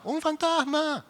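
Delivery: very high, slow, drawn-out "fantasma"; terrified wail. Retold or imitated. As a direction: let "fantasma" wail out in terror. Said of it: "el primero que lo vio dijo". (gemido aterrado, muy agudo y lento)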